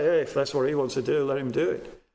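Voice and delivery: low, quick, indifferent aside; verbal shrug. Acted. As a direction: mimic someone shrugging it off. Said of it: "To shrug your shoulders and say".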